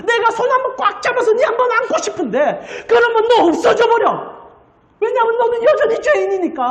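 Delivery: high, aching plea with a catch of tears; God weeping. Retold or imitated. God weeping over Moses, high tearful plea. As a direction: plead through tears, voice straining upward. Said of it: "하나님이 우는 거예요".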